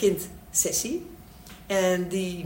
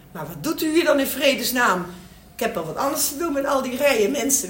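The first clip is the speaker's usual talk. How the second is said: raised, louder exasperated telling-off; irritated doctor, pitched up; acted impatient doctor; 5 semitones higher, 5 dB louder than usual. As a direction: scold a time-wasting patient. Acted impatient doctor scolds patient.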